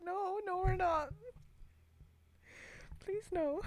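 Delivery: high, giggly mock plea; pleading with own brain. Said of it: "I have to be like".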